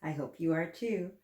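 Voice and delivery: plain reading voice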